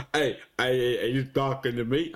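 lightly voiced film tough guy, low; half-committed impression of an action star; Sylvester Stallone. Game player muddling film tough guys, light low impression. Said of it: "Sly Stallone".

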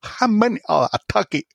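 put-on reading in a light Chinese accent. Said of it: "Just speak normally".

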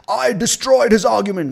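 high, loud gloating cry; mock triumphant boast. Debater bragging about winning, mock triumphant shout.

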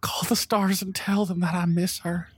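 high-pitched grieving plea; tearful plea. Acted. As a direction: plead in a small, high, grieving voice. Grieving character's plea to healer.

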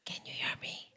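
barely audible whispered question; hushed, voiceless phone voice; imitated whisper. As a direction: whisper as if secretly phoning for help. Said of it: "Why am I still whispering".

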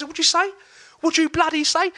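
a tiny fierce woman daring them to repeat it; confrontational challenge. Retold or imitated. Mum challenges the skinheads, loud high furious challenge.

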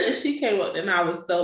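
normal talking voice

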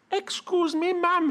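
high, polite, bashful farmer voice; timid country man. Acted. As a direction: ask shyly in a high voice. Tygeen asking the girl timidly.

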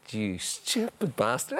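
slow, drawn-out insult; retold jeer. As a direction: stretch out the insult mockingly. Retold jeer at beaten keeper.

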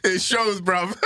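high, shrieking laughter around the words; real uncontrolled laughing fit; laughing hard. Real, not acted. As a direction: crack up and tease through it. Laughing at friend's home town.

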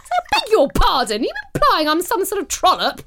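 mock indignation. Mock outrage at partner.

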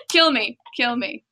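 ordinary delivery

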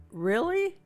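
surprised. Retold or imitated. Doctor reacting to her daughter, mock astonished. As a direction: let the doctor's surprise jump up.

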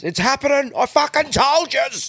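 shrill high paranoid yelp; mock tinfoil hatter vindicated; paranoid conspiracy nut. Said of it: "imagine if it was one of their yards".

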